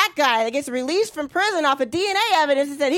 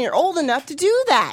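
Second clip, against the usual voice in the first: ordinary talk, nothing marked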